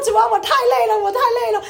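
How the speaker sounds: loud, high-pitched wail to the Lord; worn-out plea, pitched high and loud; exhausted outcry. Retold or imitated. Crying out to God in exhaustion.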